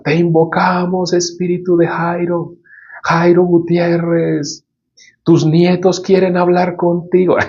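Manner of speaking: high, sustained monotone mock chant; straining séance medium. Sorcerers summoning Jairo for his grandchildren, straining mock chant. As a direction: imitate a medium straining to summon the dead, very drawn out. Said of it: "hacen fuerza".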